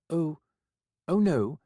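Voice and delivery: flustered fright; squeak of alarm. Acted. Florian high loud.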